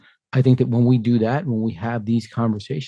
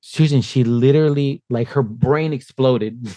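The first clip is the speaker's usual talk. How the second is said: ordinary delivery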